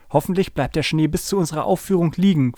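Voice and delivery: ordinary delivery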